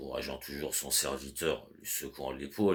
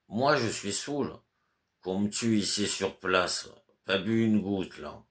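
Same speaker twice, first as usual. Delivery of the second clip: put-on drunk servant; 5 semitones higher, 3 dB louder than usual. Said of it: "ivre-mort".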